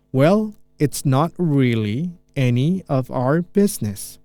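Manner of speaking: slow, deliberate teacher read; very slow model line. Shown as a demonstration. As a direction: drag it out for learners to follow. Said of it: "saya pelanin".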